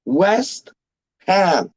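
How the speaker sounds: "West", long pause, then "Ham"; two big words. Shown as a demonstration. Fan spelling out his club's name, two words with a pause. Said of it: "two big, like, like, words".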